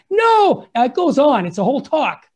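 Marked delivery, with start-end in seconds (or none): 0.1-0.7 crowd shout. Retold or imitated. Crowd answers the Pope.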